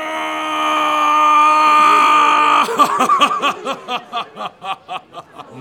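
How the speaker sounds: loud high cackling laugh. Acted. Crier bursts into big laugh.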